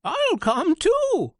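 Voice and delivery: high, loud, slow turkey voice; eager turkey. Acted. Turkey joins the chick and goose. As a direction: drawl it out as a keen turkey. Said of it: "said Turkey Lurkey".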